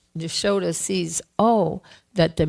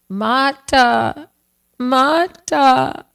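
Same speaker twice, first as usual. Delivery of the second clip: put-on child calling for his mother, higher, louder, rising; 8 semitones higher, 9 dB louder, much slower than usual. Little Krishna calls his mother from his bed, loud and high. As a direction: call out loud for Mata from the bed. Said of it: "But he's calling her".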